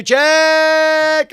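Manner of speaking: high, loud drawn-out check; loud mic check. Shown as a demonstration. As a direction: belt it out for the levels. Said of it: "We're setting levels".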